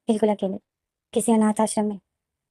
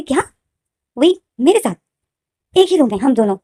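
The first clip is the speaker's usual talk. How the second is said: high, loud disbelieving outburst; shocked protest; 5 semitones higher, 5 dB louder than usual. Acted. Zain shocked at brother sharing room.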